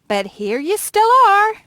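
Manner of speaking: high, loud, rising to the last word; exasperated complaint. Acted. Shopkeeper to the man who never leaves, exasperated. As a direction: wail it, out of patience.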